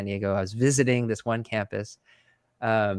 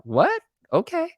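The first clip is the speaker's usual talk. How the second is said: mock surprise; 4 dB louder than usual. His stunned reaction, retold.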